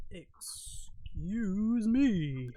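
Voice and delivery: very slow, stretched-out words; slow eerie drawl. Acted. Masked nurse greeting the visitor, stretched slowly. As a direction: drag it out eerily. Drawn-out voiced nurse line.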